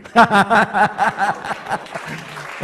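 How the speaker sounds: loud, high whooping laugh; hearty preacher's laugh; big laugh. Real, not acted. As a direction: burst out laughing at your own punchline. Laughs at the punchline.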